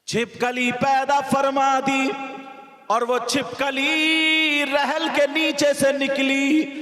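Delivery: high, loud preaching at full force; fiery sermon. Acted. Preacher warning the girls in full voice. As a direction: drive the tale home like a preacher.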